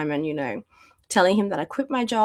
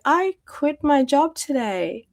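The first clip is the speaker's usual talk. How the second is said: retold announcement; 7 semitones higher, slower than usual. Telling husband she quit.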